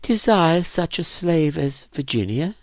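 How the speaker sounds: put-on high, tentative girl's voice; hopeful question. A narrator's reading. Virginia asks whether Relius could want her, hopeful. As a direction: ask it high, shyly hoping.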